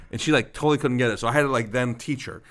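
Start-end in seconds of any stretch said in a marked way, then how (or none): none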